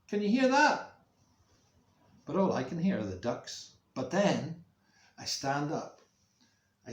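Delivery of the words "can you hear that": high, loud urgent question; grandad's voice. Acted. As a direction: call out as the listening grandad. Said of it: "He says".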